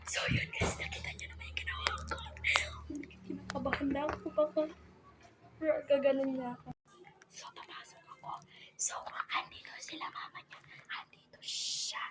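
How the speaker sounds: hushed, secretive whisper. Real, not acted. Prankster updating viewers with the family nearby, whispering. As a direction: keep it under your breath for the camera.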